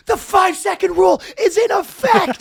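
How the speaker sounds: announcer hyping a dropped hot dog, loud and high; over-the-top announcer. Retold or imitated. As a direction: bellow it like a ring announcer. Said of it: "And they go".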